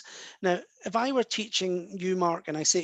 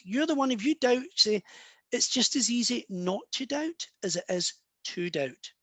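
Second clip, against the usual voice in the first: ordinary talk, nothing marked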